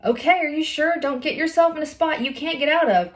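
high, loud worried warning; a friend's loud worried warning. A narrator's reading. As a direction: warn her friend from below. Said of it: "Graceland warned".

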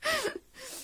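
sudden high burst of laughter; yelping laugh. Real, not acted. Laughing at getting caught.

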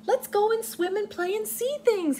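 high, loud and eager; happy excited. Acted. Swimmy calling happily to the little red fish, high and eager. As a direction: burst out with joy at finding friends. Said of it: "he said happily".

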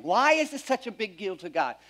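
ordinary talk

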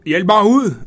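high, loud cry to be let out; shout for help. Acted. Grandma trapped in folding bed, shouting loud for help. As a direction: yell it, trapped inside the bed. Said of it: "råbte hun".

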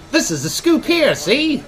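high, fast-talking 1930s newsman; snappy old newsreel reporter; old-timey reporter. Retold or imitated. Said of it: "talking like an old-timey reporter".

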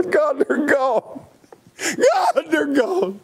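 high, broken crying, slow and strained; sobbing in grief. Retold or imitated. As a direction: sob out the loss as if reliving the dream. Preacher retells sobbing in his dream.

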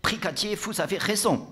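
high, eager agreement; fawning gendarme. Acted. Gendarme agreeing with his brigadier, fawning. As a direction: back up the boss eagerly. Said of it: "appuya le gendarme".

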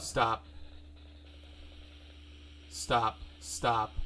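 real urgent pleading, accelerating; urgent repeated stop. Real, not acted. Viewer begging the scary video to stop, urgent accelerating repeats.

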